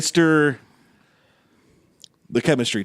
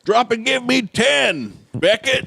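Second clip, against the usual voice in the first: drill sergeant; 9 semitones higher, slower than usual. Pretend. Coach barking at kid.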